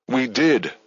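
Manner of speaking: defensive. A narrator's reading. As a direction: push back on the accusation. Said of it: "Midge defended".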